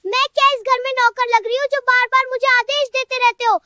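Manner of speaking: indignant scolding. Acted. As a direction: scold him for ordering you about. Acted quarrel, wife resents orders.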